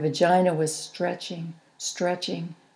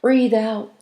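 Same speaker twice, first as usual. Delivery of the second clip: firm raised coaching call; 4 semitones higher, 7 dB louder than usual. A narrator's reading. Midwives coach the laboring mother, raised. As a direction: urge her to breathe out. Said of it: "they said".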